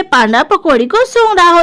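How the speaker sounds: ordinary delivery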